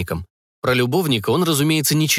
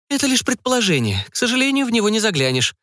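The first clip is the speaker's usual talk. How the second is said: put-on much higher, teasing young woman; mock her partner's doubts, voice pitched well up; Emily, incredulous; 10 semitones higher than usual. A narrator's reading. Emily teasing Jacques about secret society, high and incredulous.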